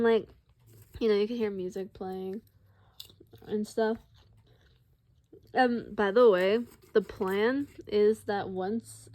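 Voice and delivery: ordinary talk, nothing marked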